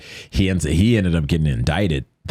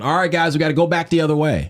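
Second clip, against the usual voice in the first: raised-pitch relayed instruction; retold call-out; 9 semitones higher than usual. Speaker passing the order to people behind, retold call-out. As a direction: call it back to the group, higher.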